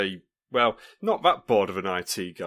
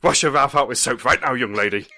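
stern scolding parent; 2 semitones higher, 6 dB louder than usual. Retold or imitated.

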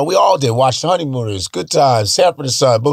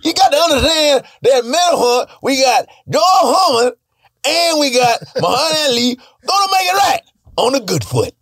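much higher, shouted nonsense hype; 11 semitones higher than usual. Pretend. Singer rambling about the fight, high and shouting. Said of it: "he'd be like".

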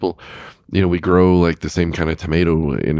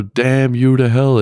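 slow, higher, louder mock movie yell; Charlton Heston yell; 8 semitones higher, 5 dB louder, slower than usual. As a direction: drag it out like a dramatic film hero. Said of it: "same kind of yell he does".

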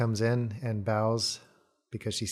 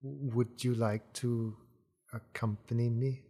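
slow, soft, lightly voiced servant; servant's voice; 5 dB quieter, slower than usual. Servant softly invites Tira to follow her, slow. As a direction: ask softly and slowly, a touch timid. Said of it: "And then she says".

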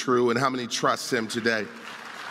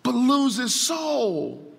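higher, driving pulpit voice; raised, insistent sermon delivery; impassioned preaching; 7 semitones higher than usual. Real, not acted. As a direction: preach it with urgency, punching key words. Preaches on the passage.

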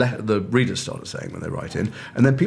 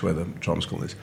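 ordinary talk

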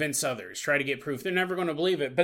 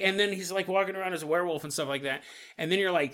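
ordinary delivery

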